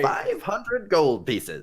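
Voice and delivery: drawn-out price announcement. Acted. Shopkeeper states price.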